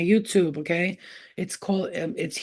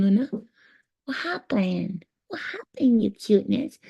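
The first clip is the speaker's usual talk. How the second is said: higher cooing pet voice; 4 semitones higher than usual. Real, not acted. Talking to his little dog Luna, higher baby-talk coo.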